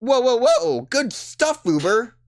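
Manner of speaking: very high, loud cheer that cracks; squeaky cracked hype voice; voice cracking. Acted. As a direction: whoop excitedly until the voice breaks. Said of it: "Voice cracked on that one".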